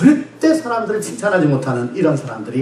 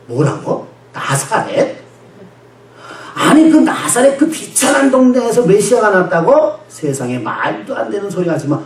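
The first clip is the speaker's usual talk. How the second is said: higher, slow, disbelieving retort; mock Nathanael's outraged disbelief; incredulous scoff; 5 semitones higher, slower than usual. 나다나엘이 빌립에게, 나사렛 출신 메시아를 비웃으며.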